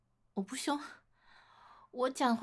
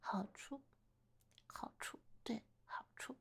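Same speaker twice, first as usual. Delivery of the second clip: teasing whisper; 12 dB quieter than usual. Acted. Teasing about what she gains.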